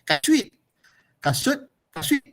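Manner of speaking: kasut said with a Perlis lilt, pitch rising high; Perlis accent. Shown as a demonstration. Lecturer demonstrating Perlis kasut to students, high rising Perlis lilt. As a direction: Perlis dialect kasut, sung upward. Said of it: "the Perlis dialect is".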